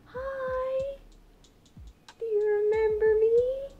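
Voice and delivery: very high, drawn-out cooing to birds; high-pitched coaxing of pet birds; pet talk. Real, not acted. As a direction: greet pets in a slow sing-song high voice. Coaxes her pet birds.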